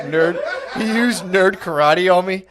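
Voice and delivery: slow, laughing through it. Real, not acted. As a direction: chuckle out each word slowly. Laughing retelling of being beaten.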